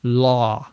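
law repeated alone, long open aw; isolated model word. Shown as a demonstration. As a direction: say law on its own for learners. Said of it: "L A W is pronounced law".

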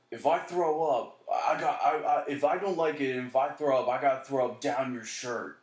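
hammed-up drunk threat to the girl; lightly voiced drunk. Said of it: "and he's just like".

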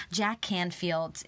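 normal talking voice

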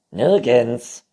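Nirgends called loudly. A narrator's reading. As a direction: shout Nirgends so he hears. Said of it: "rief das Neinhorn". (Nirgends laut gerufen)

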